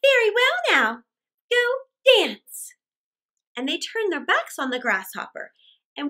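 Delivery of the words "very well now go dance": high, loud mocking brush-off; scornful dismissal. Ants dismiss the begging grasshopper, high, loud and scornful. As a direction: send him away with contempt. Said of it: "they cried".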